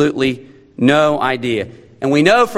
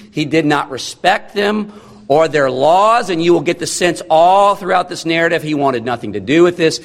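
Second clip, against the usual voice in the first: ordinary talk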